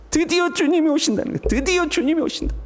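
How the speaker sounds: high-pitched joyful announcement; overjoyed. Acted. Persecuted believers hearing the Lord comes, overjoyed. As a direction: herald long-awaited news with joy. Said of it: "기쁨이 충만하지 않겠습니까".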